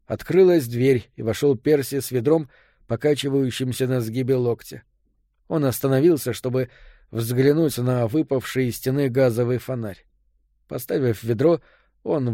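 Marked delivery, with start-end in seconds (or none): none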